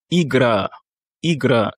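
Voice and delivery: Igra in Russian, stress on the final A; Russian pronunciation. Shown as a demonstration. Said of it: "l'accentuation se situe sur le A en fin de mot".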